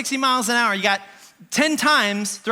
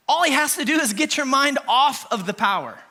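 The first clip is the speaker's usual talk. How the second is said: usual speaking voice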